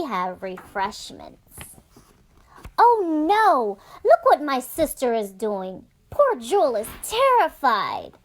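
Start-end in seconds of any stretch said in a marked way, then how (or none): 2.8-8.2 put-on girl seeing her sister scare the puppy, alarmed and loud. Alarm at sister's rough hugging.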